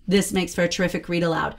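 ordinary delivery

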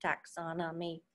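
taxonomy in a monotone robot voice. Shown as a demonstration. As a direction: say taxonomy like a robot, even and mechanical. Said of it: "Let's say it like a robot".

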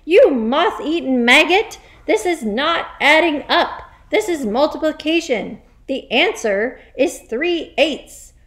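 loud, high, furious tirade; yelled. Acted. Headmistress berates boy over multiplication, yelling. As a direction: keep yelling, hammering the lesson home. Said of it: "yelled the Trunchbull".

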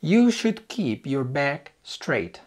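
slow, careful teacher read of the sentence; slow model sentence. Shown as a demonstration. Teacher reading slowly to the student. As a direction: spell it out slowly for the learner.

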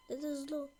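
ordinary talk, nothing marked

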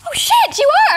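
stunned stranger recognising her; sudden realisation. Retold or imitated. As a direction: gasp it out as it dawns on him. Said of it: "then he just looked at me and went".

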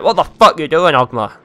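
hammed-up indignant reproach; mock scolding. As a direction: play someone berating the player. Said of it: "slap me about and be like".

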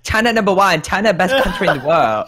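high-pitched mock Chinese accent; put-on Chinese accent. Player disguising themselves from opponents, mock Chinese accent. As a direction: chant it in a caricatured Chinese accent. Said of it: "even when I do this".

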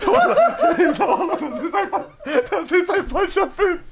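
words squeezed out through high laughter; laughing. Real, not acted. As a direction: keep laughing while you talk. (unter Lachen gesprochen)

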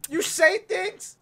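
mock hurt. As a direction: play the deeply offended friend. Mock hurt friend storming off.

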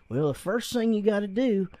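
slow, easygoing East Texas drawl. Retold or imitated. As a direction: drawl it like a laid-back East Texas dad. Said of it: "he's real East Texas, real super easygoing and real quiet".

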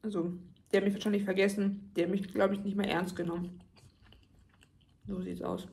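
chewing between words; eating while speaking; talking with food in mouth. Real, not acted. Seen 1: Eats pasta throughout; took bite just before, lips working between words.

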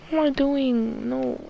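ordinary delivery

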